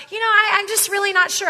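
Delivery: lightly voiced unsure buyer; unsure prospect. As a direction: play the doubtful customer.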